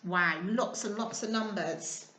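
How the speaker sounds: plain reading voice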